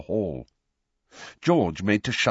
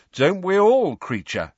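teasing; 11 semitones higher, 6 dB louder than usual. A narrator's reading. Twin teasing.

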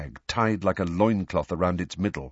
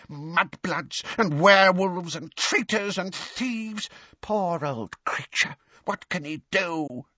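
hoarse muttering house elf; 9 semitones higher than usual. A narrator's reading. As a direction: mutter it like a spiteful old elf. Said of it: "muttering under its breath in a hoarse, deep voice like a bullfrog's".

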